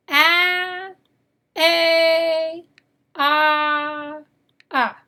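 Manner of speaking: "A, A, ah, ah" as isolated vowel sounds, short a, long a, broad ah, uh; reciting vowel sounds. Shown as a demonstration. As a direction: sound out each vowel in "A, A, ah, ah" for learners to repeat. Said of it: "Let's say the sounds again".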